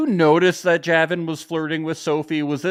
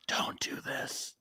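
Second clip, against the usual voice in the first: slow, hushed whisper; whispered warning; 14 dB quieter, slower than usual. Pretend. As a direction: whisper it slowly like a secret warning in someone's ear. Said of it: "like, whisper in your ear".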